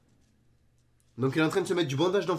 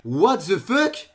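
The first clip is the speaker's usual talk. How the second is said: drawn-out, rising question; puzzled disbelief; 3 dB louder, much slower than usual. Real, not acted. Reacting to too-fast solves, puzzled disbelief. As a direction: stretch it slowly into a baffled question. First, held-back disbelief before shouting it.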